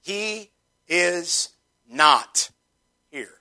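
"He is not here" with a long pause round "not"; one slow word at a time, "not" standing alone; emphasis on NOT. Shown as a demonstration. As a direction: set "not" apart between pauses. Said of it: "I like to emphasize that word".

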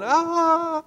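high-pitched mock scream; inner scream. Speaker showing inner frustration behind polite front, mock scream. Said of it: "And inside I'm going".

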